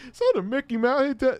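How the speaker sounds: stilted, high line reading; son as bad actor. Son asking dad about his shirt, hammy high voice. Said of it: "the worst actor ever".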